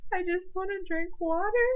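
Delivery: high, tearful whimper; crying whine. Retold or imitated. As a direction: whine it through tears. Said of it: "And I'm like".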